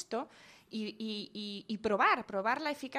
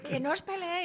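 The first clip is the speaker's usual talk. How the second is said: higher, louder exasperated scold; 7 semitones higher, 7 dB louder than usual. Retold or imitated. Tired parent scolding brothers again, exasperated loud scold. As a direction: scold them again, worn out. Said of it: "esto es continuo".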